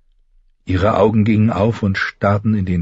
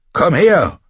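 urgent command; 8 semitones higher, 4 dB louder than usual. Acted. Knight orders help, in pain.

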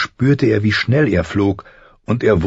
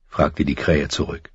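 usual speaking voice

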